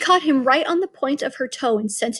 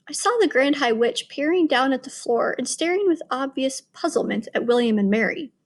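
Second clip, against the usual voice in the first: plain reading voice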